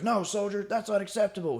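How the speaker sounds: high-pitched impression of an eccentric old vet; imitated old veteran. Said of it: "one of those older guys".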